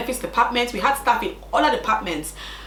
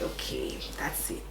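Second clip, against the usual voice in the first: breathy, barely voiced okay; defeated whisper; 13 semitones lower, 13 dB quieter, slower than usual. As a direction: whisper it, holding back tears. Resigned reply, near tears.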